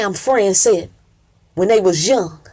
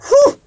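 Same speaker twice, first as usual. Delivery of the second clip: high loud whew; 5 dB louder than usual. Acted. Preacher's exclamation.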